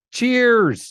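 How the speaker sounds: high, loud cheers; toast. Real, not acted. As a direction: raise a glass and call it out. Toast in studio.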